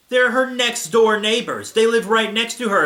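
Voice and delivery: high, loud incredulous protest; exasperated outburst. Real, not acted. Reader objects to the plot hole, exasperated outburst. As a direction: raise the voice in disbelief at the nonsense.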